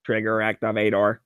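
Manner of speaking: flat robot voice, "activator" spaced out; a light robot voice. Retold or imitated. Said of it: "say it in the robot voice".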